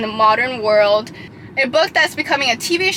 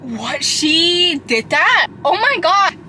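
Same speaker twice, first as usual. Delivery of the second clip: stunned disbelief; 4 semitones higher, slower than usual. Retold or imitated. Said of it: "I was like".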